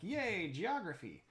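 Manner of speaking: sarcastic, pretend-excited yay. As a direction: fake enthusiasm for geography. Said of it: "which I know everybody's super excited about".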